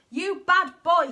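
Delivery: slow loud scolding; angry hiss. Acted. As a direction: snarl it at the dog. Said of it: "he hissed".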